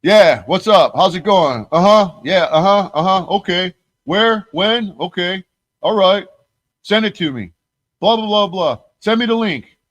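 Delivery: high, bouncy phone-call voice; imitating Flax on the phone. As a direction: play the eager friend on the call. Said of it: "And it was like".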